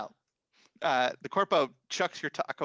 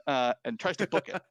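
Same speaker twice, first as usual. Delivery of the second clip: ordinary talk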